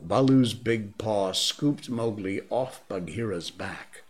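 usual speaking voice

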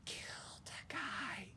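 high, hushed, slow whisper; shocked whisper. Acted. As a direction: gasp it under your breath.

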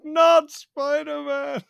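high, loud, slow wail of not spider-man; howling not Spider-Man at the movie screen; anguished cry. Retold or imitated. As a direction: stretch out not spider-man in kid despair. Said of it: "The most honest, heartbreaking".

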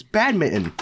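"badminton" said as PAD-mi-tən, the n before the t dropped; correct pronunciation. Shown as a demonstration. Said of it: "that's not how you pronounce it".

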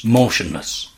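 ordinary delivery

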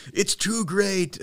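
high, drawn-out wail of mock panic; playful over-the-top panic; mock freaking out. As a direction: stretch it out high, hammed-up and frantic. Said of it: "They're freaking out".

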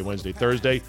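ordinary talk, nothing marked

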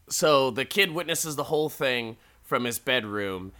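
ordinary talk, nothing marked

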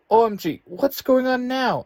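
very high, loud screech. A narrator's reading. Morphers start beeping, she screeches in alarm, very high and loud. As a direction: shriek it out in alarm. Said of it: "Angelique screeches".

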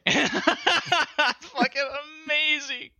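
high, slow words broken by laughter; cracking up over the game; laughing delight. Real, not acted. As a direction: laugh it out in awe.

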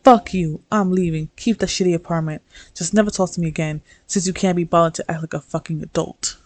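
loud, fast burst of anger; angry tirade. Acted. A partner's breakup text to the narrator's character, angry. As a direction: fire it off as a furious breakup.

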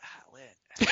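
voiceless rasped croak; strangled, barely audible hoarse attempt; can't-speak voice. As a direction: imitate having totally lost your voice. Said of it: "I literally can't speak".

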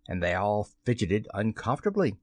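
plain reading voice

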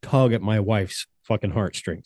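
usual speaking voice

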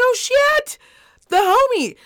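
high, loud delighted squeal at the homie; excited recognition. Retold or imitated. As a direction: spot an old friend and light right up. Spotting old flame across bar.

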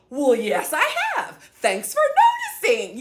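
loud, emphatic, amused retort; proud comeback. Acted. Answering people who say she changed, loud proud comeback. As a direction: throw it back at them loudly.

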